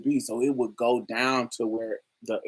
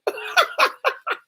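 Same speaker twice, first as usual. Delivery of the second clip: high laugh. Real, not acted. Laughing at own story.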